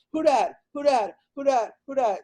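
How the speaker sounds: put-on high toddler voice asking over and over; imitating his toddler. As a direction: mimic a little kid pestering with questions, pitched high. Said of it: "because she goes".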